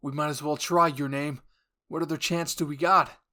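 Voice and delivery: loud urgent call; Jean shouting back urgently, loud; loud reply. Acted. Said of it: "Jean replied".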